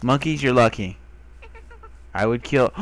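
ordinary talk

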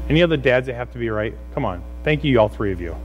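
ordinary talk, nothing marked

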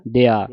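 ਦਿਆ as "deaa", short first vowel; common misreading demo. Shown as a demonstration. Teacher showing how we misread it, ਦਿਆ as dea. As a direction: run ਦਿਆ together as dea. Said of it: "ਐੜੇ ਕੰਨਾ ਬਣਾ ਦਿੰਦੇ".

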